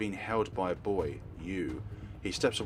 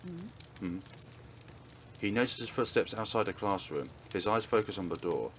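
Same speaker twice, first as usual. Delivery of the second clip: ordinary delivery, nothing marked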